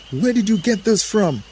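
high, agitated demand; accusing Adam. A narrator's reading. Adam questioning Eve about the fruit, high accusation.

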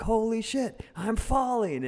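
high, excited mock scream; thrilled yelp mid-freefall; screaming and laughing. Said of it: "screaming and laughing".

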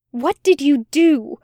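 a touch of angry demand. Acted. Angel confronting the demon, mild angry demand. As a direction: press him hard, understated anger. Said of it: "Aziraphale demanded ... anger flashing".

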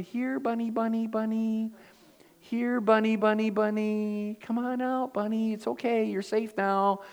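retold high little coax, "here, bunny, bunny". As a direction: sweet-talk a frightened bunny out of hiding. Coaxing a scared rabbit out.

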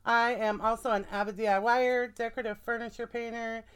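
usual speaking voice

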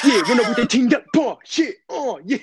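high, loud London grime-MC hype; grime-style London accent. Shown as a demonstration. Friend demos favourite accent for others to guess, London grime voice. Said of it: "Just like grime".

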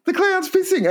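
very high mock child wail; frightened little child. Scarred girl telling her mum about the clown, high mock child voice. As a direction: imitate a traumatised child, hammed-up. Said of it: "she said that scarred her".

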